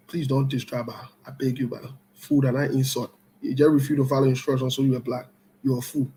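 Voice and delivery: usual speaking voice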